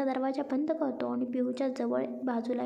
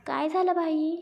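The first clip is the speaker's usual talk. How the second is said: higher, louder shout from below; 4 semitones higher, 5 dB louder than usual. A narrator's reading. Friend downstairs shouting up to the groom, loud. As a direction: call it out loud to the balcony. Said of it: "खाली शिव जोरात ओरडत बोलतो".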